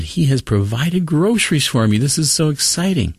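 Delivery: high-pitched, thrilled storyteller's imitation; excited voice lifted well above normal; joyful excitement. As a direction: act out an elated woman's good news. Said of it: "cried it out with joy".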